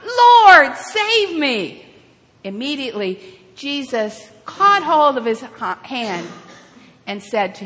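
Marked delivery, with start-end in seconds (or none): 0.0-1.9 high cry for help, loud at first; desperate cry. Acted. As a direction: cry out loudly, then fall away. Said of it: "he cried out".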